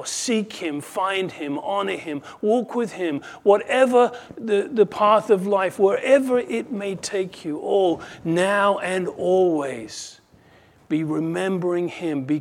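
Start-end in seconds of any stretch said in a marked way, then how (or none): 0.0-10.2 building higher and louder, then slowing at the end; fervent exhortation. Real, not acted. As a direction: urge them on with rising fervor.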